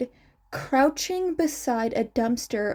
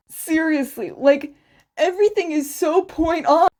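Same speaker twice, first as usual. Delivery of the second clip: gushing fan; 5 semitones higher, 5 dB louder than usual. A narrator's reading. Fan gushing, arm around him.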